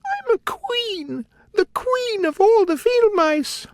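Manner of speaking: tiny but dignified; proud mouse queen. A narrator's reading. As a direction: squeak out her royal title. Mouse queen asserts her rank.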